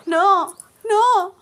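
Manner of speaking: high, loud screaming refusal; frantic no. Acted. Shweta refusing Abhi's marriage, screaming high and loud.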